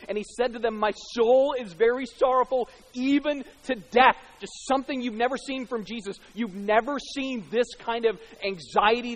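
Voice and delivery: preacher voicing Jesus' anguish in the garden; fervent scripture reading. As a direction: read it with heavy urgency. Reading the Gethsemane passage.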